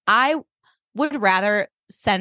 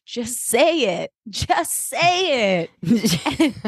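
pleading Jimmy; 4 semitones higher, much slower than usual. Retold or imitated. Jimmy pressing Kiki to admit.

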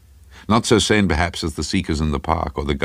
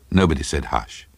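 ordinary delivery

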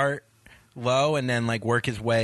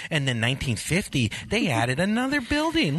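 high, dreamy gawking traveler; oblivious tourist voice; 8 semitones higher than usual. Retold or imitated. Mocking dawdling airport walkers.

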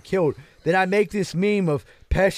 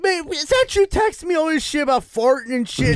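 high-pitched acted disbelief; retold high, baffled demand; incredulous realization; 10 semitones higher than usual. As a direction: question him in mock outrage. Acts out prank victim's realization.

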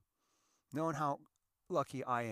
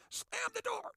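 mock shriek; slower than usual. Wife slamming car door.

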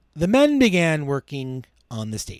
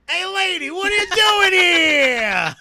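shout; 8 dB louder than usual. Acted. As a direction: holler up at someone in the balcony. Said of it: "so one guy shouts".

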